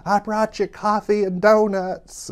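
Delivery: high, loud sing-out, syllables stretched; Norma Desmond. Retold or imitated. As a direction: trill it slowly like a theatrical diva at the door. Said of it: "strictly Norma Desmond from Sunset Boulevard".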